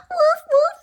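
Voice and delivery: high little woof woof; small high barks; high puppy barks. Acted. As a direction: bark like a scared puppy.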